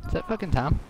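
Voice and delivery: normal talking voice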